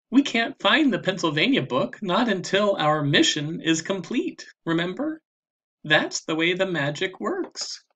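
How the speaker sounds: put-on little girl voice. A narrator's reading. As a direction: voice Annie as a bright little sister. Narrator voices Annie.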